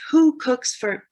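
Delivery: high, loud owl hoot; owl call. Acted. Owl poet's line as hoot.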